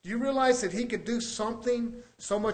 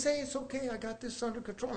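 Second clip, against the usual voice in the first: soft, nonchalant reassurance; Paul Stone; 2 semitones higher, 9 dB quieter, faster than usual. Retold or imitated. Coworker taking charge of spilt paint, calm and nonchalant. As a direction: play it quietly unbothered. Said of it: "this guy as just nonchalant".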